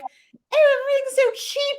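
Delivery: high, slow mock tourist. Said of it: "they're like".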